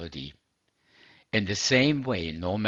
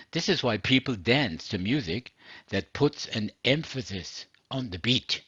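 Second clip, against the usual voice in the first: ordinary talk, nothing marked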